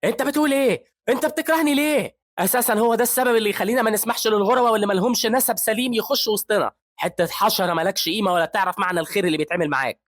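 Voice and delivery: very high, very loud raging yell; furious screaming. A narrator's reading. Professor screaming insults at the resident who grabbed his coat. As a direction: scream with contempt at him. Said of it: "بيمسكوا هان من البلطو بتاعه وبيزعق".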